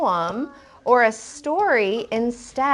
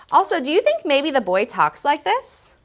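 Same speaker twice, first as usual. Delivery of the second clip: ordinary delivery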